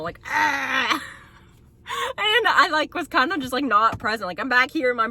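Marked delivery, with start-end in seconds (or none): 0.3-1.0 imitated animal-like groan; a light imitation of a primal birth groan. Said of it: "I sound like an animal".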